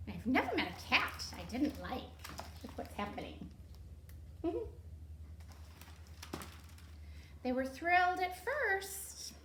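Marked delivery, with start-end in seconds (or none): 3.5-6.6 quiet high unvoiced giggle; soft amused giggle; high breathy giggle. Real, not acted. Reader laughs at the pictures.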